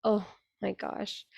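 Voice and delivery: ordinary delivery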